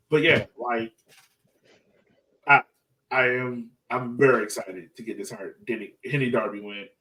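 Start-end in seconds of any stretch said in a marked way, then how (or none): none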